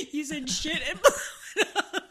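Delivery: slow, cracking laughter; laughing hard. Real, not acted. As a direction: crack up mid-sentence. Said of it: "laughing at phrasing".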